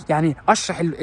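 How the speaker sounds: ordinary talk, nothing marked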